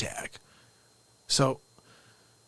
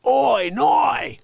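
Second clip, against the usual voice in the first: mock Australian accent, higher and louder; Steve Irwin Australian accent; 10 semitones higher, 7 dB louder than usual. Host teasing Carrie with a higher, louder mock Australian accent. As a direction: ham up the Australian twang. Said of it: "what's oi, noi".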